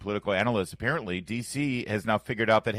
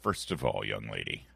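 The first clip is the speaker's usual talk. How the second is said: low, quieter mock-stern address; mock reprimand; 11 semitones lower, 5 dB quieter than usual. Host answering co-host's teasing, in mock reprimand. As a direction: put the teasing co-host in her place, low and measured.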